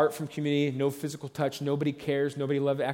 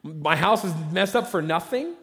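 incredulous homeowner; 4 semitones higher, 3 dB louder than usual. Retold or imitated. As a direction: drag out the disbelief over the ruined house. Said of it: "The homeowner was like".

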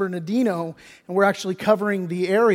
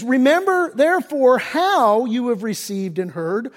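preaching scripture; 3 semitones higher than usual. Acted. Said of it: "He says".

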